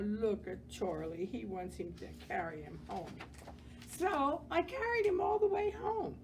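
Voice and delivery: plain reading voice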